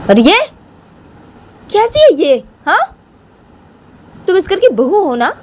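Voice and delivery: high-pitched scolding granny voice; scolding grandmother. Acted. Grandmother scolding the daughter-in-law for hurting Bani, high outraged voice. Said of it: "तो बानी की दादी बानी को बोलती".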